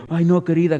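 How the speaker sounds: mock coddling. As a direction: mimic someone fussing over a dear. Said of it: "Ni ñoñería".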